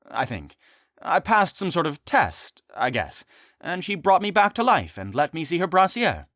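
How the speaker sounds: lightly voiced excited boy. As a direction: tumble it out like a kid. D J kid voice.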